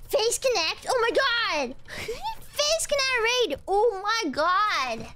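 high, drawn-out astonished cries; amazed disbelief. Real, not acted. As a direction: marvel at an unexpected raid. Surprised by an incoming raid.

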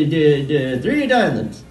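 normal talking voice

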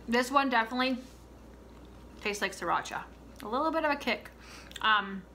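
talking with mouth full; eating while speaking; chewing popcorn. Real, not acted. Seen 3: Picks popcorn from the cup, hand to mouth, chewing between remarks.

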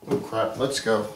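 ordinary delivery

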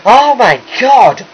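loud, high, drawn-out gasp of horror; shocked cry. Acted. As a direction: cry out at a hideous sight. Startled by the sight of the fish.

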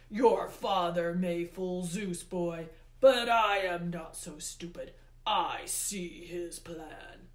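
slow, loud, imperious god; Hades, scornful. A narrator's reading. Hades tells the boy he sees through his father, scornful. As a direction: accuse with slow, weighty emphasis.

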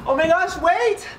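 high, urgent outburst; suddenly remembering, pitch jumps up; flustered alarm. Acted. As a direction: burst out in a high-pitched panic. Owner remembers forgotten thunder coat.

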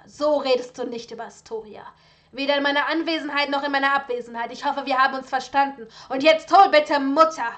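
loud, furious commands; angry outburst. Acted. Son rages at father over his dead wife, loud fury. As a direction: shout him down in rage.

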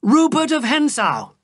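Rupert of Hentzau called high and loud; shouted name. A narrator's reading. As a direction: hurl the name out in triumph. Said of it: "I shouted".

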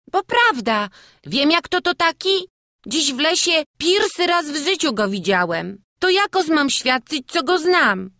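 put-on very high, loud child's voice; small boy. A narrator's reading. Wawrzuś explains himself to the burmistrz, child's voice. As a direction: explain earnestly as a little village lad.

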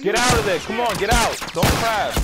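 frantic yelling. Real, not acted. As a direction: yell at a stuck teammate to move. Yelling at teammate in firefight.

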